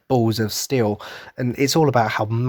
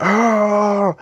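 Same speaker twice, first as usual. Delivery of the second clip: high, loud whoop of joy; joyful cheering cry; 10 semitones higher, 5 dB louder than usual. Retold or imitated. Imitating Irvin cheering back at the crowd, joyful. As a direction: cheer back like a delighted child. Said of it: "he does it again".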